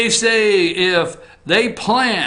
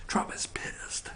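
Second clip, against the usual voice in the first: slow hushed whisper; mock whisper; 17 dB quieter, slower than usual. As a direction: breathe it out secretly. Whispered aside.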